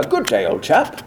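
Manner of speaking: deliberately bad, slow British accent; hammed-up fake British, drawn out; terrible British accent. Shown as a demonstration. Joking with young co-star, deliberately terrible British accent. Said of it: "we'd do terrible British accents".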